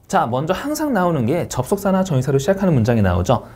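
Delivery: usual speaking voice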